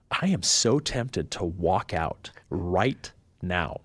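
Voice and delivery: slow and weighty, word by word; deliberate, tempted. Retold or imitated. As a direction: draw out each word as a retold inner resolve. Retold thought of walking out on job.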